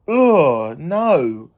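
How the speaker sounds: ordinary talk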